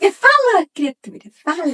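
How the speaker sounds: sudden loud high impatient "Fala, criatura"; impatient shout. Retold or imitated. Friend urging a speaker to get to the point, exasperated loud. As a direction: snap at someone to just say it.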